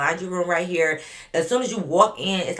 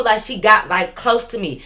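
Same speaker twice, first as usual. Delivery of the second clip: ordinary talk, nothing marked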